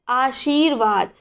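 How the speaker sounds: आशीर्वाद said slowly with the r on va; slow correct form. Shown as a demonstration. Teacher slowly modelling आशीर्वाद for students. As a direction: stretch out आशीर्वाद deliberately. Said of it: "सही क्या होता है".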